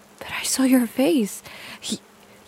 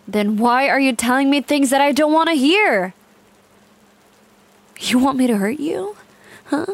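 louder, hard-edged demand; furious threat; 3 semitones higher, 6 dB louder than usual. Acted. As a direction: snap at him with menace.

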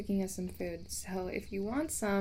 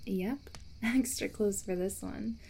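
ordinary talk, nothing marked

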